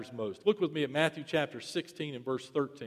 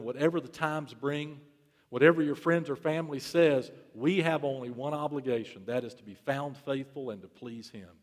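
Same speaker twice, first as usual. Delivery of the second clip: ordinary talk, nothing marked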